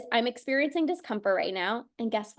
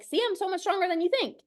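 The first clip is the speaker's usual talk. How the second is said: higher and fast, playfully triumphant; proud playful body voice; 6 semitones higher, faster than usual. Retold or imitated. Coach voices the body crowing over the doubting mind, higher and fast. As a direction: let the body crow that it won. The body boasts after workout.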